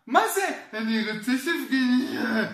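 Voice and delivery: put-on high-pitched whiny child's demand; ילד דורש. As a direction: mimic a nagging kid, jumping up high at the start. (ילד מנדנד בקול גבוה)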